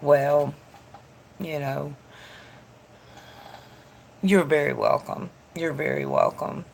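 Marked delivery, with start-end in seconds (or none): none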